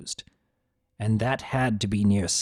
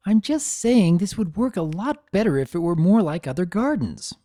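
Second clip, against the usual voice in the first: put-on insistent young mare, pitched higher; insisting filly; 7 semitones higher than usual. A narrator's reading. Filly insisting to her mother about the garden, pitched up. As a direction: press the point, a little petulant. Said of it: "Summer Sky insisted".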